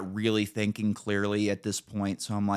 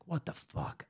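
muttered curse; 7 semitones higher, 13 dB quieter than usual. Retold or imitated. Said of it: "I'm like".

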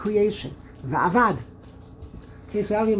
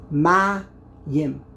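"Ma-yim" split in two, loud MA, pause, yim; syllable by syllable; 5 dB louder than usual. Shown as a demonstration. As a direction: break "Ma-yim" into two separate beats for a learner. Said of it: "has two syllables".

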